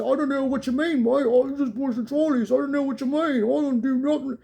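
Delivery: high, mock-innocent British accent. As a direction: play a dodgy British bloke who knows nothing, high-pitched. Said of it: "in British, you have people say".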